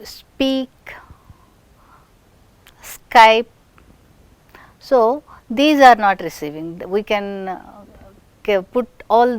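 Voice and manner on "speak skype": "speak" and "Skype" with no puff after the s; unaspirated p, k. Shown as a demonstration. Teacher contrasts with pin, "speak, Skype" said without aspiration. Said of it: "and without".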